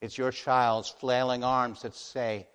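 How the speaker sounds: ordinary talk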